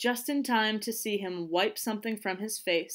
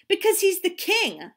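high, loud insistence; urgent protest; 11 semitones higher, 7 dB louder than usual. A narrator's reading. Princess protesting to the boys.

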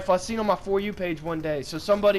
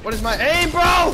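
loud, rising angry shout at his own aim; frustrated shout; 11 semitones higher, 8 dB louder than usual. Real, not acted. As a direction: yell it out, fed up and higher. Missed shots in the game.

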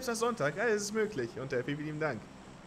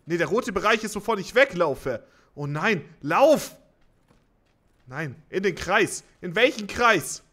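panicked shouting; 5 semitones higher, 8 dB louder, slower than usual. Real, not acted. Panicked gameplay, red zone closing.

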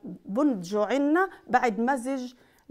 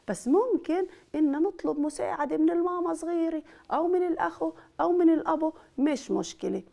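normal talking voice

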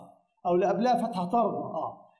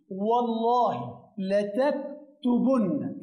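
والله لتكتبن stretched very slowly, each syllable clear; slow model phrase; much slower than usual. Shown as a demonstration. Teacher dictating the emphatic verb slowly to students. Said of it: "إيه اللي حصل هنا؟".

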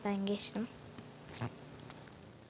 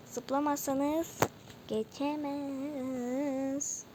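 slow, higher, long-stretched syllables; drawn-out warning; 3 semitones higher, 3 dB quieter, slower than usual. Shown as a demonstration. (uzata uzata uyarı)

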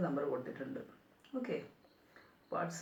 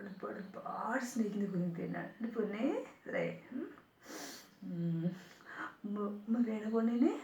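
put-on affectionate baby talk, higher and louder; cooing baby talk; 3 semitones higher, 3 dB louder than usual. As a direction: sweet-talk a toddler awake, lilting and affectionate. Cooing baby talk to little girl.

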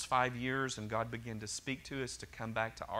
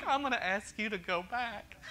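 imitating an amused God, pitched high; high-pitched teasing quote on the edge of a laugh; 9 semitones higher than usual. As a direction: say it as if tickled by your own plan. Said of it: "he's really laughing because he's like".

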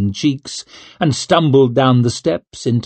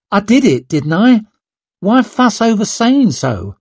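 defiant retort; 9 semitones higher, 3 dB louder than usual. A narrator's reading.

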